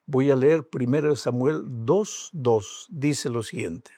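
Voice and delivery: ordinary talk, nothing marked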